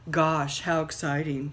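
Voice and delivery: deadpan, flat sarcasm; low, bored sarcasm; unexcited. Acted. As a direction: sound totally unimpressed. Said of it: "in a very unexcited voice".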